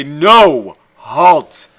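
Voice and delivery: commanding shout. Retold or imitated. Gamer voicing himself ordering his units, loud command. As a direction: stop them dead with your voice. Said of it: "I say".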